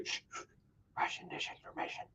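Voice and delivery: hushed, secretive whisper; mock whisper. Host mocking the official cover story, in a hushed whisper. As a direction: whisper it like a forbidden phrase.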